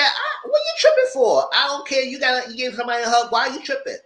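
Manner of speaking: high-pitched voice for the woman, brushing it off; mock woman's voice. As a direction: play her shrugging it off, way up high. Woman's reply voiced in retelling.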